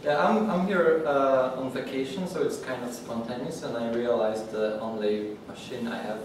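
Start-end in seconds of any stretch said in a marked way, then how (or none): none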